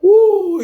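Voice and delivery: high, loud "woo" after laughing; high laughing whoop. Real, not acted. Catching breath after laughing fit.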